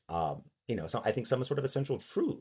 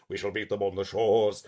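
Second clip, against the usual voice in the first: big, deep orator's voice; deep basso profundo. Shown as a demonstration. Showing a deep voice that needs amplification, basso profundo. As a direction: intone it deep, as if close to a microphone. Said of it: "basso profundo is not really going to carry".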